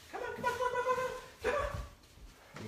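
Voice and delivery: high puppy call. Real, not acted. Trainer coaxing the puppy over, high sing-song call. Calling the puppy around room.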